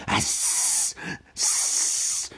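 unvoiced broken hiss; upset hissing. A narrator's reading. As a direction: hiss without voice, at a loss. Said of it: "said Gollum, more upset".

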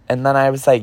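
usual speaking voice

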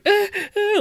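high indignant squeak; mock offended squeal. Imitating the Karen's offended squeal. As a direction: shriek like you've been slapped. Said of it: "She was like".

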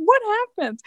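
high squeaky astonishment; mock bewilderment. Mother reacts to sumo-wrestler baby, high comic bewilderment. Mock shock at huge newborn.

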